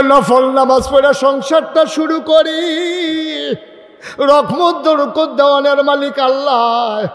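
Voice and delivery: full-force preaching. Real, not acted. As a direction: ring it out to the crowd, pitched high.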